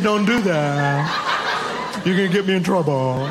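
lightly voiced kid, slow and giggly; a child pleading her giant-cat story; apologetic little girl. Kid quoting herself to the cat.

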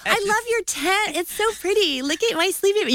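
high-pitched put-on gushing; fake friendliness. Wife pretending to other campers, fake friendliness. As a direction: admire it with exaggerated sweetness. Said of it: "pretend like you know how".

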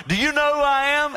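high, loud, haughty challenge; arrogant emperor. Retold or imitated. Roman emperor challenging Christ, loud and high. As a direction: demand it like a proud emperor. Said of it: "the Roman emperor".

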